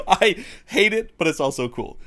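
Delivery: talking through hiccups; hiccuping between words. Real, not acted. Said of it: "No, I got the hiccups".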